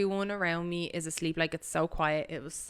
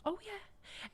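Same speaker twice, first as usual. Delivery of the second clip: imitating a hushed reply; 14 semitones higher, 12 dB quieter than usual. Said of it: "she was whispering".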